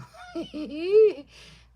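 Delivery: loud high burst of laughter. Real, not acted. Laughing at her joke to viewers, loud high laugh.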